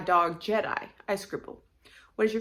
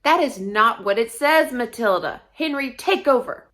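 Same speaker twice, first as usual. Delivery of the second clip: louder, raised-pitch reprimand; a touch of exasperated teacher; scolding teacher; 4 semitones higher, 8 dB louder than usual. A narrator's reading. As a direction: cut in sternly on a misbehaving student. Teacher interrupts a rogue student announcer.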